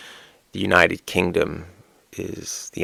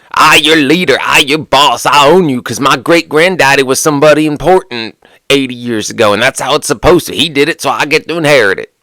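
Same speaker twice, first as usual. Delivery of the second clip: loud, higher, hammed-up entitled boss; mock entitled boss; 7 semitones higher, 6 dB louder than usual. Mocking the good old boys club, a higher, louder hammed-up boss. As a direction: lord it over them like an inherited big shot.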